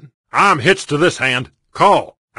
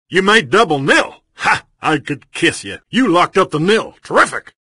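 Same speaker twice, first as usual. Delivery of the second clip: ordinary talk, nothing marked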